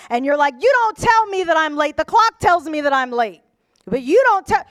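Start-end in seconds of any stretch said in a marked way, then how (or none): 0.6-3.4 imitating a touchy employee, starting high; hammed-up defensive snap; mock indignant retort. Acts employee snapping at boss. 3.9-4.6 mock indignant retort. Employee keeps arguing with boss.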